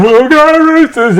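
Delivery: mock Scotty Cam. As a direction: squeak it out like a silly fan. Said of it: "he's come out today saying".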